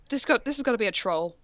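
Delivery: usual speaking voice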